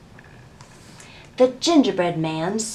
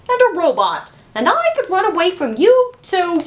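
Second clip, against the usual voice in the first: much higher, loud, quick boastful chant; cocky gingerbread man; 11 semitones higher, 6 dB louder than usual. A narrator's reading. Gingerbread man taunts everyone chasing him, high and cocky. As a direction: tease the chasers, bouncing and bragging.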